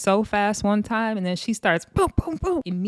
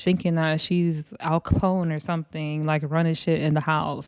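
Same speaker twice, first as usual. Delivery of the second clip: normal talking voice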